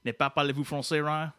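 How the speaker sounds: put-on French-Canadian accent, in French; mock French questioning; Quebecois Dave. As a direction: ask haughtily in mangled French. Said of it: "let Quebecois Dave do it here".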